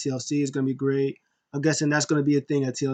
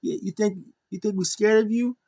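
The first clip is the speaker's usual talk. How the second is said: higher jeering voice; mock callout; 7 semitones higher than usual. Wrestler jeering his rival, higher dare. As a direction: jeer and dare. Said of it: "He's like".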